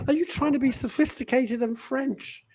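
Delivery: a touch of high, mock-exasperated ranting. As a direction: tease the audience with a pretend scolding. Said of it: "I said".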